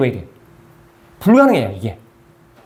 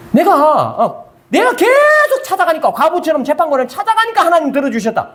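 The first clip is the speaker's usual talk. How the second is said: mock self-credit; 7 semitones higher than usual. Preacher imitates someone claiming persistence won God over, raised mock voice. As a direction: ham up a person who kept pestering God.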